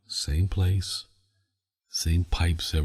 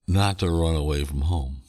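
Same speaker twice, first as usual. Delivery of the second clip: ordinary talk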